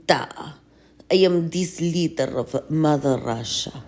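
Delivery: a hint of a heavy Russian accent, ponderous; Putin with a Russian accent. Healer greets Russian leader, mild Russian accent. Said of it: "Putin, are you, like, the leader".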